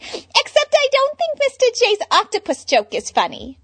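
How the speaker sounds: high, loud bubbly Harley. Acted. Harley chatting to Bruce about presents, bubbly. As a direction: gush like a ditzy fangirl.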